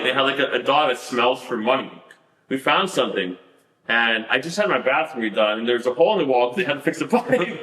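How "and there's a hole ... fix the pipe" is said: normal talking voice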